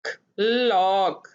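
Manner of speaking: Clock stretched out slowly, vowel held long; teacher draws out clock sound by sound; slow blending. Shown as a demonstration. Phonics teacher to pupils, stretching the word slowly. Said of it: "क्या बना?".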